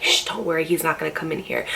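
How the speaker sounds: calm her friend in a near whisper; hushed reassurance. Retold or imitated. Friend hiding her under a blanket, soft hushed reassurance. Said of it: "she covers me and she's like".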